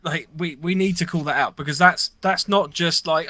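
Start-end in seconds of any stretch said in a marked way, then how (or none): none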